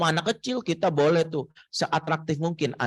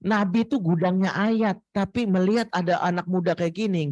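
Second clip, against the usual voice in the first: normal talking voice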